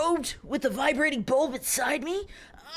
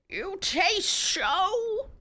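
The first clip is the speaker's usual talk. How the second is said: put-on very old man voice; 6 semitones higher, much slower than usual. Old man character line, drawn out.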